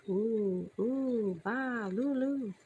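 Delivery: high, wavering oohs; ghostly warbling noises. A narrator's reading. Haunted house sounds heard again, ghostly warbling. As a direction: wail musically like a distant ghost. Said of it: "The sounds again".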